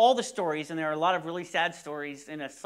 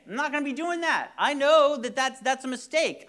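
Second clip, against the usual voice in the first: mock dismissal; 8 semitones higher, 5 dB louder than usual. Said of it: "And you might think".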